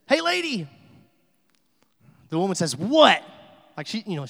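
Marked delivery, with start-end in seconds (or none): 0.1-0.7 very high, loud squawking holler; shrieky parrot hail, pitched way up; parrot's call. Acted. Parrot hailing the lady again at the pet store, high loud squawk. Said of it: "The parrot says". 2.8-3.2 loud, high, exploding 'what'; ready to fight. Acted. Fed-up lady answering the parrot, loud confrontational yell. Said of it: "ready to go".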